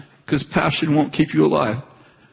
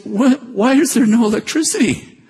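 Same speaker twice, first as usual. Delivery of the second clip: laughing disbelief; 9 semitones higher than usual. Retold or imitated. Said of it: "I said".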